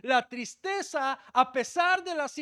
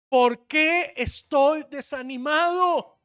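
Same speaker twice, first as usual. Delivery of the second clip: slow, loud shouted lament; anguished cry; 2 semitones higher, 7 dB louder, slower than usual. Acted. Preacher voicing David to his congregation, slow shouted lament. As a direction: cry the question out to the hall.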